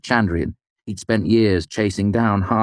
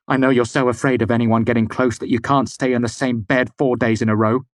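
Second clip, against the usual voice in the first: high, heated tirade; angry; 6 semitones higher, 3 dB louder than usual. A narrator's reading. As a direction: pour it out at her. Kvothe lashes out.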